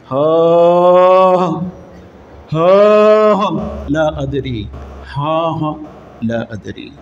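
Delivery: groaning in despair. Retold or imitated. Unbeliever in the grave groaning he doesn't know. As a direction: gasp out haa haa, then sink to a mumble. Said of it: "ఆ రకంగా శబ్దం తీసి అంటాడు".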